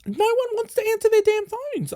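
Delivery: very high, held-up exasperated voice; high-pitched exasperation. Retold or imitated. Caller whose family won't answer, high-pitched exasperation.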